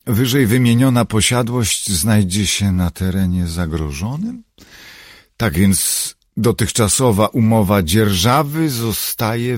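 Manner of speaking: high, loud, emphatic official reading; reading a letter aloud. A narrator's reading. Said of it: "Star odczytała fragment listu".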